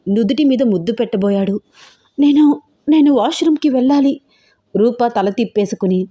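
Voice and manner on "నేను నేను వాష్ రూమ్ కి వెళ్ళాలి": higher, uneasy hesitant request; hesitate, then ask uneasily in a raised pitch; a touch of awkward unease; uncomfortable, awkward. A narrator's reading. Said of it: "ఇబ్బందిగా చెప్తోంది".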